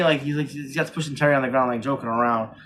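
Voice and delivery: ordinary talk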